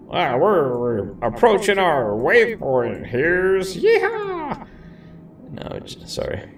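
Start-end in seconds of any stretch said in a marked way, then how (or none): 0.1-3.7 higher, slow cowboy twang. Pretend. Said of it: "I already sound like a cowboy". 3.7-4.7 very high whooping yeehaw; cowboy yeehaw. Pretend. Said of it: "too much".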